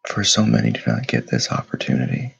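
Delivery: guided meditation. Acted. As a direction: lead them through stillness, unhurried and steady. Leads a morning meditation.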